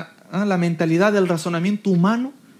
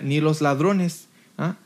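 slow, lower-pitched Bible recitation; measured, low scripture delivery; scripture reading; 4 semitones lower, slower than usual. Acted. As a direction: read the list deliberately, pausing between items. Resumes reading the scripture list.

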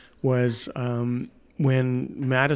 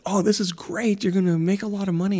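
higher and fast, eager; excited kid; 6 semitones higher than usual. Retold or imitated. Son Brendan excited about scratch tickets, higher and fast. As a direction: gush like a kid who thinks he'll get rich. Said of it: "and so Brendan said".

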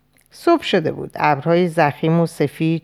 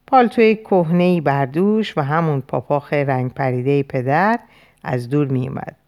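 usual speaking voice

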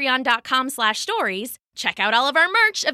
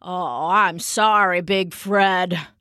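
put-on low, slow old-man apology; apologetic Papa; 5 semitones lower, slower than usual. A narrator's reading. Papa makes up with his dog, apologetic. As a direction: apologize slowly in a lowered Papa voice.

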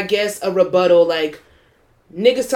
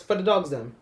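slight Toronto patois lilt on "For the Dogs Them", "Them" said like "dem"; a hint of [name]'s Toronto accent on "For the Dogs Them"; 3 semitones lower, 6 dB quieter than usual. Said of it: "Are you saying it with the accent, like how he says it?".

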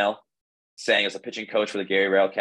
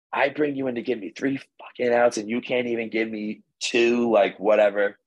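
mildly heated imitation of a manager, pitched up; mock berating, raised pitch; retold angry chewing-out; 3 semitones higher than usual. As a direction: reenact a cursing coach's dressing-down, not full force. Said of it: "just, like, mother effing me".

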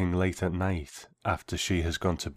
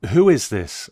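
loud, sharp suspicious demand; 5 semitones higher, 10 dB louder than usual. A narrator's reading. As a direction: snap the question at the caller. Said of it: "I said, suspicious".

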